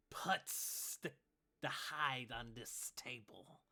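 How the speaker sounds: slow hissing snake-man shopkeeper; serpent accent. Acted. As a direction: stretch every s in a slow serpent voice. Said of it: "in the serpent accent".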